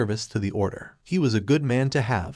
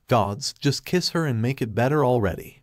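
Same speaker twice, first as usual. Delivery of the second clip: usual speaking voice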